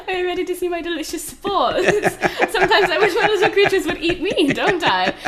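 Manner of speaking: high, laughing, gleeful host voice; imitating Spore creator. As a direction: giggle through it like a giddy presenter. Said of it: "That's the creator of Spore".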